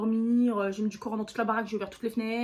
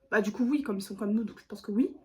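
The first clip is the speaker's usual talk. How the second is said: usual speaking voice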